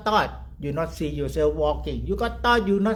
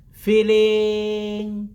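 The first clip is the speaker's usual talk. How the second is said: FEELING sustained long on one raised note; drawn-out; 4 semitones higher, 4 dB louder than usual. Shown as a demonstration. Teacher urging students to stop thinking, drawn-out. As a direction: stretch FEELING right out, then let it fade.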